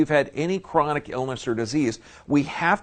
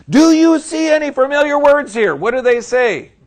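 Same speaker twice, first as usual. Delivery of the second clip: much higher and louder, a revival preacher whipping up the room; fired-up preaching; 10 semitones higher, 11 dB louder than usual. Acted. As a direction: shout it to the back rows with full fervor.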